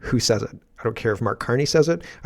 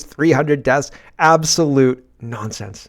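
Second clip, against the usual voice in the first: ordinary talk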